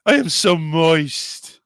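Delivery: slow, drawn-out tease; deliberately slow, mock sultry; teasing drawl. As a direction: stretch it out to gross them out. Saying the dirty word on purpose.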